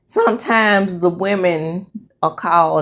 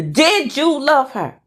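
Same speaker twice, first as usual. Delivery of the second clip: higher, insistent demand; insistent questioning; 8 semitones higher than usual. Acted. Wife demanding husband's answer, higher and insistent. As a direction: lift the pitch and demand an answer.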